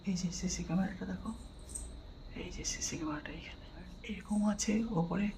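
hushed whisper in a silent place. Real, not acted. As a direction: whisper so no one is disturbed. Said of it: "আমি খুব আস্তে আস্তে কথা বলছি". (ফিসফিস করে)

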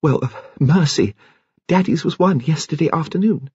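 put-on high, trembling young woman's voice. A narrator's reading. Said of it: "Rose said in a trembling voice".

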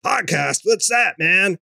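mock surfer guy, hammed-up; Simpsons bus driver. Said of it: "talking like the bus driver from The Simpsons".

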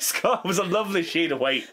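ordinary talk

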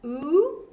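"Oo" as a long rounded oo; oo sound. Shown as a demonstration. Teacher models the "Oo" vowel for kids. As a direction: round the lips for "Oo". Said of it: "this is a tricky one".

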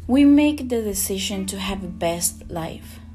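normal talking voice

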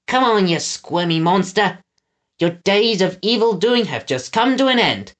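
high-pitched henchman playing the hero; Pickles, triumphant. A narrator's reading. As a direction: declare victory over the monster. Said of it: "Pickles' voice continued".

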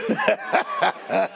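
a sudden high-pitched burst of laughter; preacher laughs at his own joke with a high squeal. Real, not acted. Real high-pitched laughter. Laugh after mock threat.

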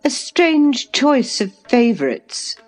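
high, slow, scornful woman. A narrator's reading. Hostess to guest about his mistake, scornfully. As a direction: draw it out high with disdain. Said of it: "she observed scornfully".

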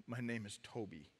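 very soft, low mumble; broken mumble. Acted. Beaten man in his own blood giving in, mumbling. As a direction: barely get it out, all fight gone. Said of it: "he mumbles these words".

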